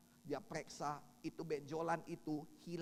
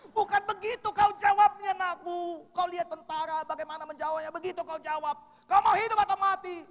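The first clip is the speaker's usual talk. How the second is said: loud stern scolding, much higher; father's bark; 14 semitones higher, 14 dB louder than usual. Retold or imitated. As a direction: drill it like an army sergeant. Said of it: "dia bentak saya". (omelan keras ayah seperti tentara)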